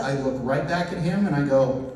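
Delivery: normal talking voice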